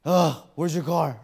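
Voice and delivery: begrudging and annoyed; mock grumpy helper; wrong attitude. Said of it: "with the wrong attitude".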